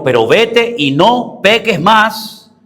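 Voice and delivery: high, very slow preached command; emphatic command. Acted. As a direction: proclaim it word by word. Preacher voices the Lord's command. (mandato solemne, lento y enfático)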